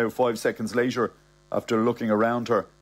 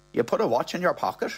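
higher, quick accusation; brisk, higher female character; accusing woman; 6 semitones higher, faster than usual. Acted. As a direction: voice a woman pointing the finger. Bernie accusing the old man.

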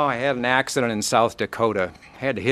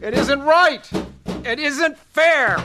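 high, slow pained protest; anguished protest; 4 dB louder, slower than usual. Acted. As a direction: cry out against the unfairness. Railing at God after crash.

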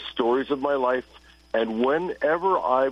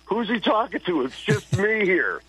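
higher, bemused voice of a confused listener; puzzled listener; 7 semitones higher than usual. Retold or imitated. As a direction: play the baffled guy alone in his room. Mocking a puzzled radio listener.

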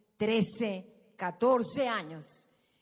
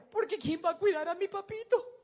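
put-on higher little-girl voice; imitating little daughter; 6 semitones higher, 4 dB louder than usual. Daughter asking who will care for her daddy, higher. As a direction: plead like a small child. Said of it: "Y Ana María decía".

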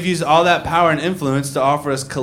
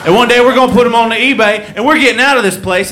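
loud, high hopeful yokel; 7 semitones higher, 6 dB louder than usual. Retold or imitated. Collector telling family their eBay plan, loud hopeful yokel. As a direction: promise the escape with pride.

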